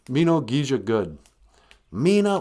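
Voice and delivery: usual speaking voice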